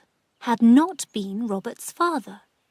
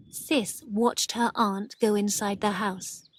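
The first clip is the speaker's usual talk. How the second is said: usual speaking voice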